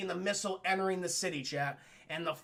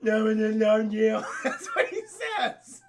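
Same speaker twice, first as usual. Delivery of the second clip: real helpless laughter through words; laughing protest; 6 dB louder, slower than usual. Real, not acted. Streamer defending the quote to chat, laughing hard.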